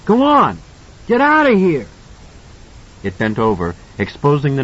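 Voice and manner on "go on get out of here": high, loud desperate yell; boy screaming at the thing; child's shout. A narrator's reading. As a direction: drive it off with your voice. Danny yells at the monster.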